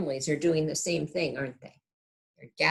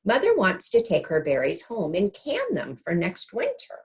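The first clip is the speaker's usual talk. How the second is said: put-on higher mother gently scolding her child; a light touch of a patient mother; mother sends Sal off to pick, lightly voiced; mother's voice; 7 semitones higher than usual. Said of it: "Her mother stopped picking and said".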